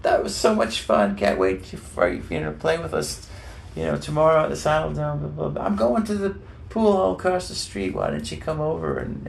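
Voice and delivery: excited high-pitched impersonation of a rock star. Retold or imitated. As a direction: call out high and eager, imitating him. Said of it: "he sort of scamps up the stairs".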